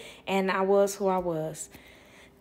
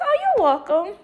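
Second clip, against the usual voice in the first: much higher and louder, bright sing-song; sing-song welcome; 11 semitones higher, 6 dB louder than usual. Retold or imitated. Replying cheerfully to the grateful mother, sing-song. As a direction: chirp it out sweetly.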